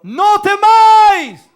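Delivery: grito potente, agudo y muy alargado. Acted. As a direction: grita a pleno pulmón como llamando desde lejos. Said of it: "Él les gritó". (grito fuerte, agudo y sostenido)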